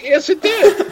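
very high-pitched incredulous comeback; retold emphatic retort. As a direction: contradict your friend with an exasperated upward squeal. Retold argument with friend, incredulous retort.

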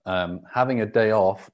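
normal talking voice

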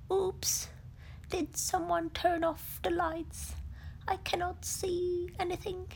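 high, puzzled bear voice, lightly voiced; confused little bear in the dark; mumbling Pooh. Pooh blinded by honey, mildly puzzled mumble. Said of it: "Pooh mumbled".

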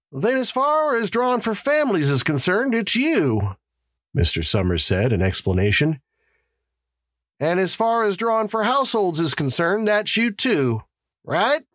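high-pitched official addressing the crowd; high character voice for announcements; Mister Summers, the lottery official. A narrator's reading. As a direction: voice an organizer moving everyone along.

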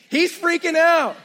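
high and loud, slow freak-out; hammed-up panic about Joey; panicked yell. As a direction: blurt it out in mock panic. Preacher acts out Joey's panic.

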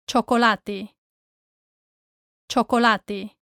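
Chocolate as cho-ko-LA-te; final E sounded. Shown as a demonstration. Teacher models for learners, Chocolate with final E sounded. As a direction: keep the last E in Chocolate, Spanish style. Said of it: "remember to say the last E".

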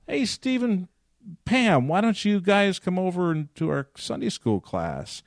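eager high-pitched invitation; inviting call. Retold or imitated. Elder inviting Steve and Pam.

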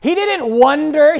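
normal talking voice

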